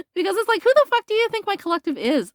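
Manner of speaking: who do you think we are, sky-high and sneering; incredulous scoff. Real, not acted. Reader scoffs at the querent's vain ex, high and incredulous.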